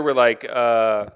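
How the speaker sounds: normal talking voice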